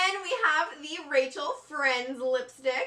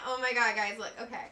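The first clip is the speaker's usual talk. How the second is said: usual speaking voice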